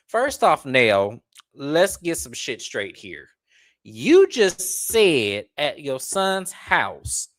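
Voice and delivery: usual speaking voice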